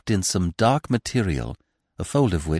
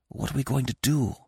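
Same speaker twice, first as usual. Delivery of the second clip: hushed question; whispered; 4 semitones higher, 8 dB quieter than usual. A narrator's reading. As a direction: ask under your breath. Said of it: "I whispered".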